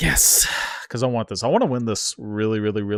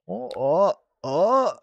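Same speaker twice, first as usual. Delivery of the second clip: high whimper; 9 semitones higher, 5 dB louder, much slower than usual. Real, not acted. Reacting to the counter.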